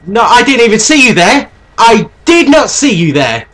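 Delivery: loud fast exasperated shout; raging gamer. Real, not acted. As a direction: yell in disbelief. Surprised death, shouting.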